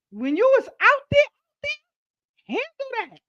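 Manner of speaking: high mocking out there; mocking scorn. Real, not acted. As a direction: sneer at them from high up. Mocking the child's excuse.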